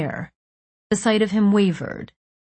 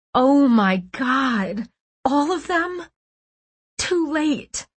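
high, stricken exclamations; horrified disbelief; 7 semitones higher, slower than usual. A narrator's reading. Narrator realising all the girls are gone, horrified. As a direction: pitch climbs in stunned horror.

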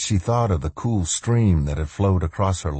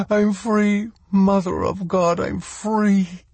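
joyful relief; slower than usual. Acted. Woman released, joyful cry.

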